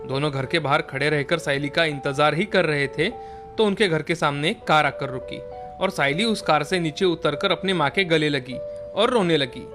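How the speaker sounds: usual speaking voice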